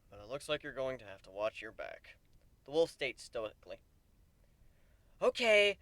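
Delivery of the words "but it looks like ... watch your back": plain reading voice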